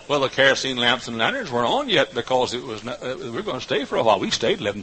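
normal talking voice

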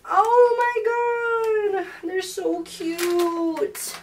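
high, very slow, delighted coo; real giddy gush; gushing squeal. Real, not acted. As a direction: melt over how cute they are. Admiring flowers.

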